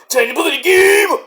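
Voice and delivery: [name] shouted high; announcer shout. Shown as a demonstration. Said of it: "sung title repeated".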